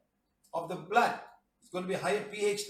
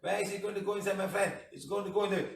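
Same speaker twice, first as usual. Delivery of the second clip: ordinary delivery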